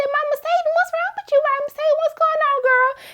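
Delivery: very high, squealing concern; high mock alarm. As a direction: call out to her like she's glitching in front of you. Calling to malfunctioning robot.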